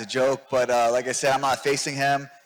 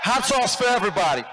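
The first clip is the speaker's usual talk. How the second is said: shouting to crowd; 10 semitones higher than usual. Real, not acted. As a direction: yell it out to the room. Yelling to crowd.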